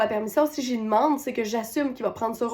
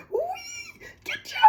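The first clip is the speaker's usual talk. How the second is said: put-on shrill sobbing scream of a little girl, far higher; crying shout; 9 dB quieter, much slower than usual. Little daughter wails over ketchup at dinner, crying shout. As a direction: wail it like a crying toddler. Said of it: "Puis là, elle crie, puis là, elle pleure".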